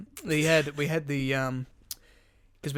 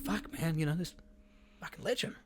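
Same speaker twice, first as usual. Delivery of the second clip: hushed, breathless admiration; awed whisper; 8 dB quieter than usual. Retold or imitated. Quietly marvelling at the young worker's beard. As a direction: whisper it like you're impressed.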